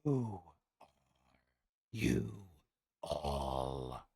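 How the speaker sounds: deep, slow beastly growl; menacing creature voice; monster voice. Acted. DM voices the Manticore.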